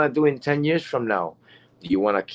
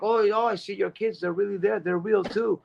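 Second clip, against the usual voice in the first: imitated excited woman; 9 semitones higher, 4 dB quieter than usual. Excited lady spotting their kids, high imitated voice. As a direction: mimic an amazed fan, pitched well up. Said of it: "she ran around and she's like".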